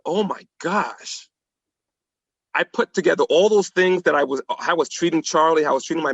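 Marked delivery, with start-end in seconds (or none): 0.1-1.3 slow, soft gasp of realization; dawning realization. Retold or imitated. Alone in the car, staring at himself in the mirror, stunned. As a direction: say it slowly under your breath, stunned. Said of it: "I looked at myself and I said".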